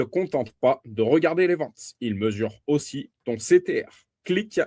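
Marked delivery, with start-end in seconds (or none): 4.2-4.6 saying Click Through Rate with an English accent. Real, not acted. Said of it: "vous m'excuserez pour mon accent anglais". (avec l'accent anglais)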